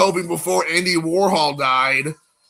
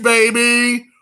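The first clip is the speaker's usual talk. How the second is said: normal talking voice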